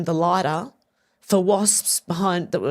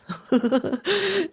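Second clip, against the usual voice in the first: short high nervous laugh; 9 semitones higher than usual. Real, not acted. Laughs at own worry.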